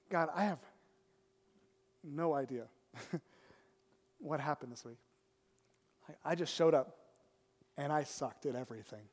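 low, soft, halting confession; hushed, low-pitched admission; humble prayer. Retold or imitated. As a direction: confess quietly to God, with long pauses. Humble retold prayer on the bench.

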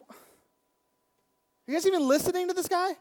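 loud, fast, high-pitched disbelief; exasperated outburst. Retold or imitated. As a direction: blurt it out, fed up with the kids. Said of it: "I'm like".

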